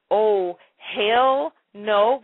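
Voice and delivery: "hell" stretched long, with a lingering L; drawn-out HELL. Retold or imitated. Reading a viewer's comment aloud, HELL stretched way out. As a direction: draw out "hell" slowly and emphatically. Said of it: "with four or five L's on there".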